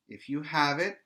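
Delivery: ordinary delivery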